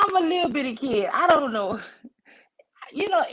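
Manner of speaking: plain reading voice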